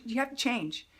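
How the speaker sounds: ordinary delivery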